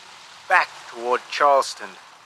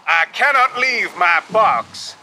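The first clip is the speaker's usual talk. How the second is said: slow, higher, stubborn protest; insistent refusal; 5 semitones higher, 3 dB louder than usual. A narrator's reading. Passenger to captain from the sinking ship, insisting. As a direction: dig in and refuse to budge.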